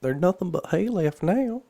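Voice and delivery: high, loud character messenger; messenger's voice. A narrator's reading.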